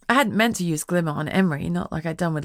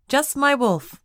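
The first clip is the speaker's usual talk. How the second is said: cheerful; 8 semitones higher, 3 dB louder, slower than usual. A narrator's reading. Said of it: "she replied cheerfully".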